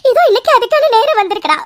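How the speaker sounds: ordinary delivery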